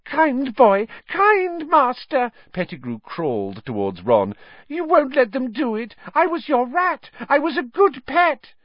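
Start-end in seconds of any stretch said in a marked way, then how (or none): 0.0-2.3 very high, loud pleading squeal; slow, fawning high wheedle; grovelling. A narrator's reading. Pettigrew begs for his life. 4.7-8.5 very high, squeaky grovelling plea; frantic high-pitched wheedling; pleading coward. A narrator's reading.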